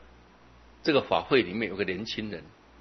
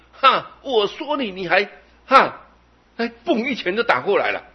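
mock indignant outburst; 11 semitones higher, 9 dB louder than usual. Preacher mocking a touchy master who snaps at the punch, loud. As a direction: play a master who takes offence.

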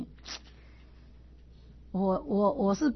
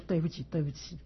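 softly murmured sorry, sorry; hurried soft apology; 3 semitones lower, 5 dB quieter than usual. Retold or imitated. Apologizing quickly to the angry classmate, softly and humbly. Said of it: "我就赶快跟他说".

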